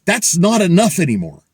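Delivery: ordinary talk, nothing marked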